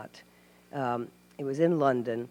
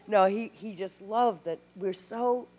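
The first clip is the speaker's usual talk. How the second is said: normal talking voice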